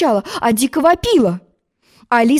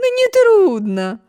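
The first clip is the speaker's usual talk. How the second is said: exclaiming; 11 semitones higher, much slower than usual. A narrator's reading. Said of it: "воскликнула".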